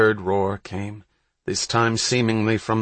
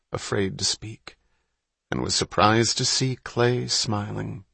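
plain reading voice

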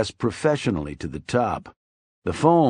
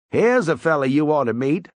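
mild Weasel voice; 5 semitones higher, 4 dB louder than usual. A narrator's reading. Weasel introduces his friend.

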